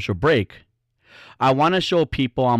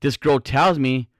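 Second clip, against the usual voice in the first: usual speaking voice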